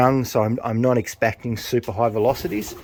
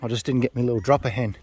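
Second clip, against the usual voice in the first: normal talking voice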